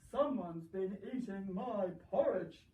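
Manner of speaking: booming daddy bear, drawn out. Acted. Telling the story to a sceptical listener, a slow loud papa bear.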